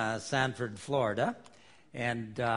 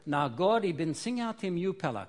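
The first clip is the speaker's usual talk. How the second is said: Pidgin read aloud, higher and lively; Melanesian Pidgin; 5 semitones higher, 4 dB louder than usual. Shown as a demonstration. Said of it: "in Melanesian Pidgin".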